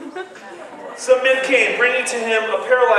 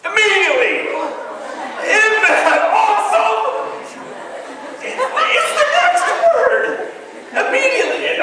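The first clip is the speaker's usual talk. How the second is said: high, loud, laughing exclamation; excited shout; 4 dB louder than usual. Real, not acted. Preacher exclaiming to the crowd, high and laughing. Preacher exults over the word.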